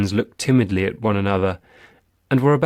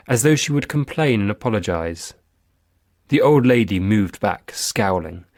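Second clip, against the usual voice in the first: ordinary delivery, nothing marked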